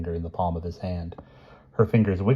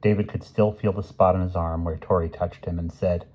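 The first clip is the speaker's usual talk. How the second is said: ordinary delivery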